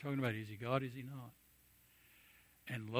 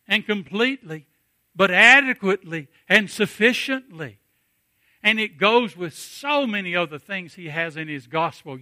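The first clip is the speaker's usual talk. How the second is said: loud, higher-pitched fervent preaching; full-force preaching; 6 semitones higher, 14 dB louder than usual. Acted. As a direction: lift the congregation with rising fervour.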